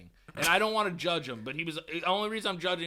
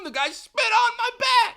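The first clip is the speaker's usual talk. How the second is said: outraged exclamation; 9 dB louder than usual. Real, not acted. Outraged at being spat on.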